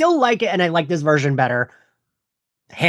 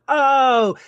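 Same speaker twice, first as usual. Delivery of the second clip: higher, drawn-out "oh" of realisation; retold realisation; 6 semitones higher than usual. Retelling a reaction to the flashback, mock realisation. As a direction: act out the moment it dawns on you. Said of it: "I was like".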